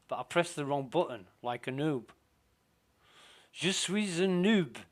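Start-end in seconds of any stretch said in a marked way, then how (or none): 3.5-4.8 a hint of a French accent, jokey; mock French accent. Gamer mocking his own noob mistake, light French accent. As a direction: say it like a Frenchman, playfully self-mocking. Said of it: "laughing at my French accent".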